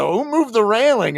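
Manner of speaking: high, loud play-acted outrage; mock indignant demand. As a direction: demand it as if wronged, hammed-up. Acted drunken character's complaint.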